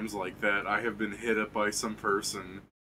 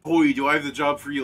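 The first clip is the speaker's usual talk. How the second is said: higher, louder mock recruiter hook; imitated scammer pitch; 5 semitones higher, 6 dB louder than usual. Joking to friends about the scammer's opener, mock sales pitch. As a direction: pitch it like an eager huckster, lightly. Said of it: "really funny just to be like".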